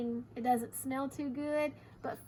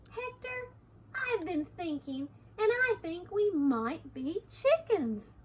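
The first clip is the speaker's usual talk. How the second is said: high, lilting dawning discovery; excited exclamation; 6 semitones higher, 3 dB louder than usual. Acted. Harriet the chicken tells Hector her discovery, excitedly. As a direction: announce a new idea, pitch lifted. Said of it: "exclaimed Harriet".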